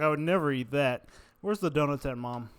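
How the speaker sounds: loud mock denial. Mock denial, calling to mom.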